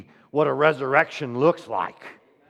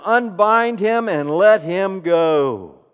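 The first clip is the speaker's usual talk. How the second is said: louder, slow and firm; commanding; 3 semitones higher, 8 dB louder, slower than usual. Acted. Jesus ordering the crowd to free Lazarus, firm slow command. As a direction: give the order with authority. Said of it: "And Jesus said to them".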